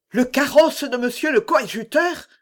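high-pitched, taken-aback question; astonished queen exclaiming; startled exclamation. A narrator's reading. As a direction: cry it out as if recoiling in shock. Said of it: "s'écria-t-elle en reculant d'un pas".

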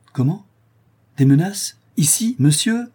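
cris indignés, bien plus hauts et plus forts; outré, la voix qui grimpe; s'écrie indigné. A narrator's reading. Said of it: "s'écria Conrad". (Conrad indigné répond au vicomte, en criant)

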